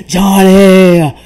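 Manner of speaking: loud mocking shout of a name, laughing at the end; mock calling out. Friend imitating him calling Johnny, loud mock yell. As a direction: belt the name out, teasing him. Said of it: "why does he keep calling Johnny's name".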